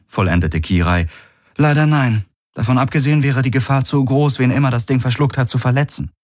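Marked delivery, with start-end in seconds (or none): 1.6-6.1 lightly voiced cautioning crew member, higher register; higher-pitched, mildly regretful objection. Crew member rejecting the plan to fire on the fish, mildly cautioning.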